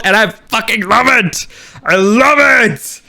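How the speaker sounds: high, thrilled fan outburst; elated gushing. Real, not acted. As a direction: gush it out, stretching LOVE. Fan gushing over the crossover.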